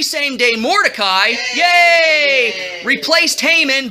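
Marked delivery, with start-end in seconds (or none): 1.5-2.8 drawn-out yay; playful cheer. Pretend. Storyteller cheering Mordecai, playful long cheer. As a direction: cheer the hero with a long shout.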